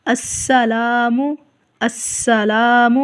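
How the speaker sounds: السلام with a hissing, whistling sin; whistled sin. Shown as a demonstration. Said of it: "سین کے اوپر سی ٹی کی آواز نکالیں گے". (السلام میں سین سیٹی کے ساتھ)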